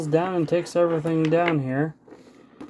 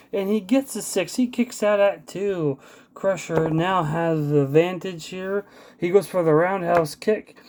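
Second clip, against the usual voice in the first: live ringside play-by-play; wrestling commentator; 4 dB louder than usual. Acted. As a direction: call the match blow by blow. Play-by-play of dice wrestling match.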